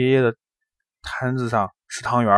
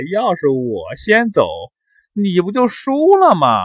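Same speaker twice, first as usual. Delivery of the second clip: gloating; 8 semitones higher, 5 dB louder than usual. A narrator's reading. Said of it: "哈哈大笑".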